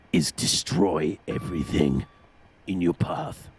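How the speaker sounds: very low, slow villainous command; sinister team boss giving orders; menacing boss. Pretend. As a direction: drop deep and draw out the order. Toto's menacing order to George.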